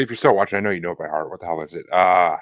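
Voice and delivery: ordinary talk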